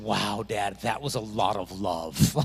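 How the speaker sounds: put-on a touch of a five-year-old's wonder; little daughter. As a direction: daughter after a long goodnight hug. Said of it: "she said".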